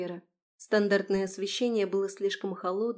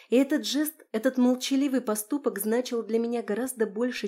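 ordinary delivery, nothing marked

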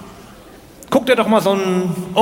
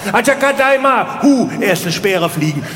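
caveman battle cries; 7 semitones higher than usual. Acted. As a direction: yelp nonsense as the spears fly. Kampfschreie der Jäger. (hohe, laute Höhlenmenschen-Kampfrufe)

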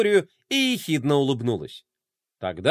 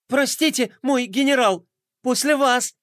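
grinning apology; 10 semitones higher than usual. Acted. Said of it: "расплылся в улыбке Мелкотрон".

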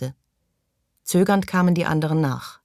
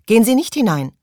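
higher, louder warning; urgent warning call; 7 semitones higher, 7 dB louder than usual. A narrator's reading. Maggie warns Morley away from the door, loud. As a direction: shout to stop him entering. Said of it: "rief sie Morley zu".

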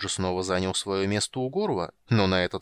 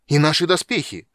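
higher and much louder angry cry; a loud, outraged shout; 6 semitones higher, 9 dB louder than usual. A narrator's reading. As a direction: yell it at the dwarf, veins bulging. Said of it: "закричал Стурм".

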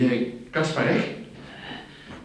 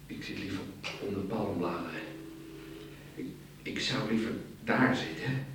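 soft, lower, far-away murmur; dreamy murmur; 5 semitones lower, 10 dB quieter than usual. Acted. Drowsy man murmuring his dream of palm leaves. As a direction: dream aloud as if half asleep.

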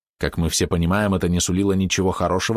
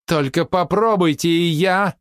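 high, stretched, louder threat; wailing cry; 10 semitones higher, 4 dB louder, slower than usual. A narrator's reading. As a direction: wail the warning in panic. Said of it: "заголосила Вира". (высокий протяжный вопль)

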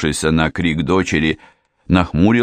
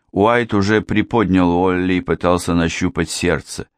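ordinary delivery, nothing marked

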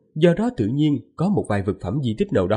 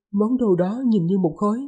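higher, lightly voiced young wife; man doing a woman's voice; 9 semitones higher than usual. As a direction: speak up high as the gentle wife. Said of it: "Lát giả giọng nữ".

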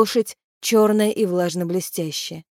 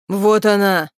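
restrained narrated cry; shout; 4 dB louder, slower than usual. A narrator's reading. Someone spotting the banshee, understated cry. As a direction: point her out with a contained shout. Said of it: "раздался чей-то крик".

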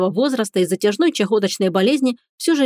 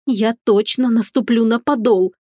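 ordinary delivery, nothing marked

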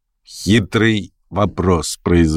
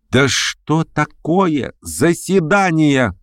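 high, very drawn-out question; crying out; 9 semitones higher, much slower than usual. A narrator's reading. Unnamed guest querying the whole vote, crying out. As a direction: call out the question, stretching it. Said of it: "крикнул голос".

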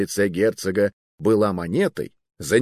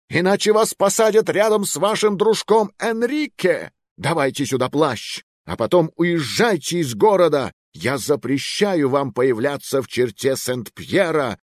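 raging king; 7 semitones higher than usual. Acted. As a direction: throw him out in anger. King banishes the youth.

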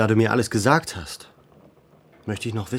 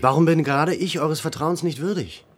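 raised, louder offended question; indignant demand; 7 semitones higher, 5 dB louder than usual. Acted. Bagradian confronts the village headman, indignant and raised. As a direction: push him, sounding hurt and indignant.

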